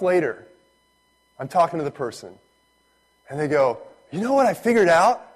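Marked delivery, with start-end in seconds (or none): 4.1-5.3 high, loud, eager announcement; mock-excited revelation; proud discovery. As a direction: share it like your own big discovery. Acting someone announcing their own discovery.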